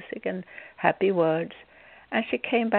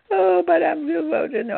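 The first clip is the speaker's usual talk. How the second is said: high, louder mock moan; mock complaining; 9 semitones higher, 4 dB louder than usual. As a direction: imitate her whining objection. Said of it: "she kept on complaining".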